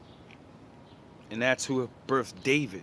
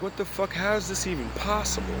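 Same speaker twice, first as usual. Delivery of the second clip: shocked disbelief; 7 semitones higher than usual. Retold or imitated. Travelers dumbfounded at the spring.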